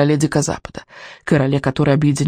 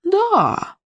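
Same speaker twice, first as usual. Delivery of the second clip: high eerie crone reply; witch voice; 14 semitones higher than usual. A narrator's reading. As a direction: answer like a gleeful witch. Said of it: "отозвалась ведьма".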